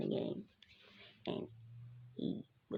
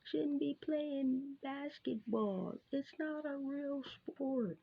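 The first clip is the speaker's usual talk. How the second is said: slow, higher mocking voice of a sexist critic; 6 semitones higher, slower than usual. Vlogger mocking critics of women's basketball, slow and higher. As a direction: drag it out like a scoffing doubter. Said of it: "people be like".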